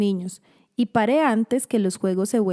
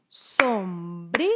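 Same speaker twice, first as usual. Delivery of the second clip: "sombrilla" slowly split as som, bri, lla; three detached beats on "sombrilla": som... bri... lla; syllable by syllable; 3 semitones lower, 4 dB quieter, much slower than usual. Shown as a demonstration. Teacher showing how to separate words, "sombrilla" split som, bri, lla. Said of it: "Separen las palabras en sílabas".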